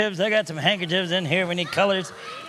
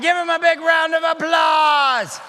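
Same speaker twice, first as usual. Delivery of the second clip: loud, high announcer call for applause; rally the crowd like a ringmaster; showman call; 6 semitones higher, 4 dB louder than usual. Acted. Magician calling the audience to cheer.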